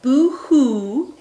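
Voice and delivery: a frightened rat's fake sob; rat crying. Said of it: "who cried".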